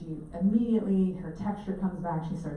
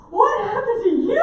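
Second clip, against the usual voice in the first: much higher, louder alarmed question with laughter; shocked husband; 11 dB louder than usual. Retold or imitated. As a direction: gasp it out as the startled husband. Husband voiced seeing her arm.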